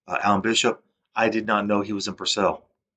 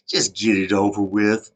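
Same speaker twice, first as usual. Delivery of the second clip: slow, louder weary insistence; impatient demand; 5 dB louder, slower than usual. Retold or imitated. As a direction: press him to say it now. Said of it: "I was like".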